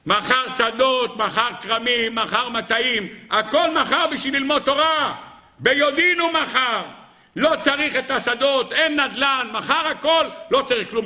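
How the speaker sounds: high, ringing exclamations in short bursts; fervent preacher's cry, pitched very high; impassioned preaching. Acted. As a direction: hammer out each sacrifice to the congregation.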